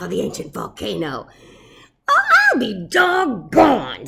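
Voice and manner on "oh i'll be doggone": high, louder amazed squeak; surprised squeaky mouse. Acted. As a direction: squeak it in delight. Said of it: "squeaked the Big Cheese".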